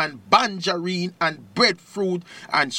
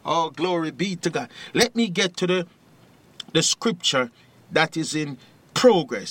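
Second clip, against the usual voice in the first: normal talking voice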